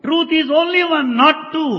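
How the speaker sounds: "only one, not two" pitched high and stressed; strong emphasis. Acted. Translator relaying Vedanta's declaration, emphatic "only one, not two". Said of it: "with such a strong emphasis and stress".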